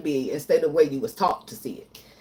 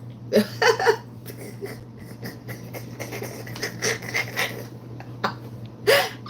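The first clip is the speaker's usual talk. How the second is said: a long, airy, high laugh at herself; high breathy laugh; 4 dB quieter than usual. Real, not acted. Laughing at her past self to viewers, high airy laugh. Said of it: "I'm laughing at myself now".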